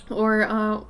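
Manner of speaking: normal talking voice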